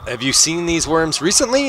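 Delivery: high-pitched worried questioning; alarmed. Acted. Skittish character asks players about worms, mildly alarmed. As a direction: ask it with rising alarm. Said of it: "his eyes get really big".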